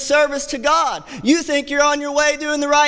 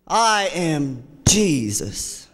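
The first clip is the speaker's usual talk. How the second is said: slow hushed reveal; 10 semitones lower, much slower than usual. Acted. Climactic quote of Jesus.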